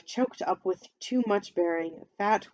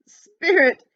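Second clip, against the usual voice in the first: loud, high desperate call; cried out; 9 semitones higher, 11 dB louder than usual. A narrator's reading. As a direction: cry out to the ghost. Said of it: "he cried".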